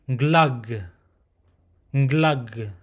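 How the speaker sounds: nglug slowed, nasal ng onset ending on a hard g; closed nglug. Shown as a demonstration. Teacher modelling the closed form slowly. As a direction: stretch nglug and stop on the g. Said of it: "Now for the closed phonetic".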